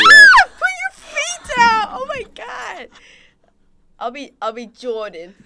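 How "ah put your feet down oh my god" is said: loud, very high, drawn-out scream; shrieked complaint. Real, not acted. Yelling at a friend to put their feet down, shrieking. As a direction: shriek it in horror.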